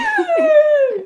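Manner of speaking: a sudden high loud laugh; high burst of laughter. Real, not acted. Laughing at her friend's pidgin imitation.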